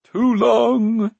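high, loud wailing voice; drawn-out high complaint; moaning Glue. A narrator's reading. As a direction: moan in self-pity. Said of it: "moaned the voice of Glue".

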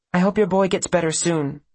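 put-on girl's kindly voice. A narrator's reading. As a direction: offer good wishes in a high voice.

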